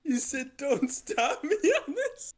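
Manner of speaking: high, slow, laughing through tears; crying with laughter. Real, not acted. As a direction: squeak it out mid-laugh. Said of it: "I'm crying so hard".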